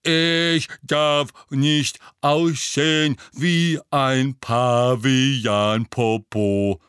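very slow, loud dim-witted grunt; Goyle grunt. Acted. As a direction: grunt it like a dim troll of a boy. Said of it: "grunzte mit tiefer Stimme wie Goyle".

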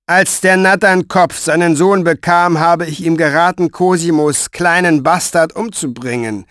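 high, loud contemptuous boast; cold Pfeifer. A narrator's reading. Pfeifer recalling his advice, high and contemptuous. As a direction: sneer at a dead master.

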